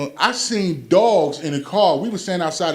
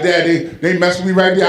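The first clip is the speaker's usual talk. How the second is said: louder, indignant son, voice raised; son's loud complaint; 2 semitones higher, 7 dB louder than usual. Retold or imitated. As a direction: rant like a wronged son. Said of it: "He said".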